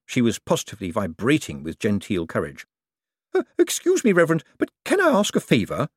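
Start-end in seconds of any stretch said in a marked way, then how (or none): 3.3-5.9 high, timid request; shy lady. A narrator's reading. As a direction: ask the favour high and shy. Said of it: "she said, going pink".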